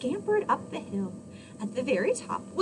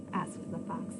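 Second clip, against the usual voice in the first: usual speaking voice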